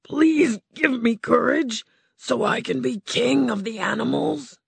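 a hint of a frightened lion asking; timid lion pleading. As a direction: beg like a scared big cat.

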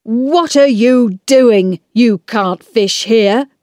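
slow, loud telling-off from a man; angry storybook man; cross shout. Acted. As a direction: scold them slowly and forcefully. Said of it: "shouted at them".

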